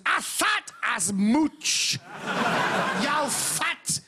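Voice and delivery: put-on very slow, drawn-out bogus Papua New Guinea accent; absurdly stretched fake Papua New Guinea accent; mock Papua New Guinea accent. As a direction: imitate a Papua New Guinea man, dragging out every word. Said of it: "A guy from Papua New Guinea".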